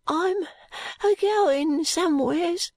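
put-on high, slow, halting boy's voice; feverish boy. A narrator's reading. Feverish boy answers vaguely.

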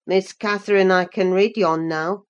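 low rustic lad, awkwardly proud; awkward elation. A narrator's reading. Unlettered cousin boasts to Catherine of reading, awkward elation. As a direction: play an awkward country lad showing off, voice dropped. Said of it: "with a stupid mixture of awkwardness and elation".

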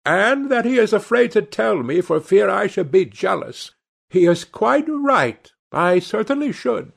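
high-pitched, animated hostess; lively, very high society-lady voice; crying out. A narrator's reading. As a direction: carry on exclaiming in a high voice. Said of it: "cried Lady Narborough".

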